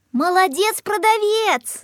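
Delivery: put-on high, drawn-out children's cheer; children shouting. A narrator's reading. Children on the street praise the shop assistant, shouting. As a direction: cheer it up high, stretching the last word. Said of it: "закричали дети на улице".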